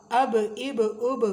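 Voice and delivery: اب with the ب popping and echoing; qalqala bounce. Shown as a demonstration. Teacher demonstrating qalqala on با. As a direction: let the با bounce out. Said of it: "بڑے مزے کی آواز نکلتی ہے ایسے".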